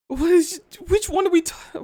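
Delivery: high, loud baffled question; flustered confusion. Real, not acted. Viewer lost over which accent is meant, flustered. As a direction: shoot up in pitch, baffled.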